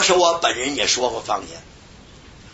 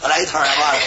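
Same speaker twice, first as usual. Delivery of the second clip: ordinary talk, nothing marked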